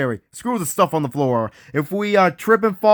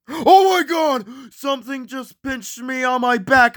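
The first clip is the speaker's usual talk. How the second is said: high, startled cry of alarm; panicking; 6 semitones higher than usual. Acted. Lost explorer pinched on the back, panicking. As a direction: yelp it out high, then keep the panic up. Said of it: "they start freaking out and panicking".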